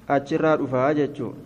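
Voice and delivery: normal talking voice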